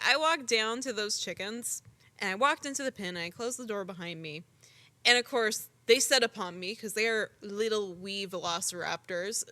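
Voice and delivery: ordinary talk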